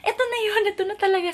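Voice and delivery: high, thrilled squeal of discovery; excited squeal. Retold or imitated. Vlogger reliving finding the watch, high excited squeal. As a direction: squeal like you finally found it.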